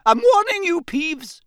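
very high, outraged warning; exasperated professor threatening; shouted warning. Acted. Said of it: "shouted Professor McGonagall".

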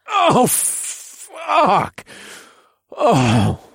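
very high climactic cry; climax cry. Acted. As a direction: let it burst out at climax. Narrator climaxing.

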